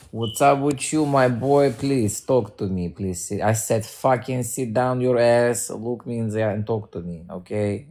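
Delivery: put-on Russian accent, bossy. Streamer demanding a boy talk to them, Russian accent. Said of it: "I'm gonna talk with a fucking Russian accent".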